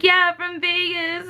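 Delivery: slow, high and awkward. Retold or imitated. Meeting the actor again, replying awkwardly. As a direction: squeeze it out awkwardly.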